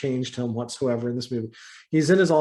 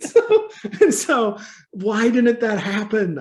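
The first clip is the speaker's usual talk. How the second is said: laughing; 7 semitones higher, 5 dB louder, slower than usual. Real, not acted. Laughing through tears.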